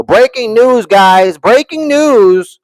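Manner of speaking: high, drawn-out mock news announcement; mock announcer. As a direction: hype it up like a big breaking-news reveal.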